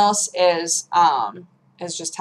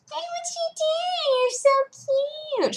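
high cooing voice to a pet; pet talk; 6 dB quieter, slower than usual. Real, not acted. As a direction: coo very high and slow. Talking to the cat.

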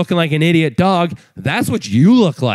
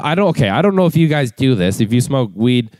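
ordinary talk, nothing marked

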